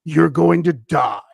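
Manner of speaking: loud, slow menacing growl; possessed-sounding threat; changed, growling voice. Retold or imitated. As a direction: pronounce a doom slowly and forcefully.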